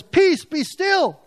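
high, loud, slow command to the storm; commanding Jesus. Acted. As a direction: rebuke the raging storm, drawn out.

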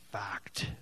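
whispered emphatic fact. Real, not acted. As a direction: breathe the word, certain. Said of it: "whispered emphasis".

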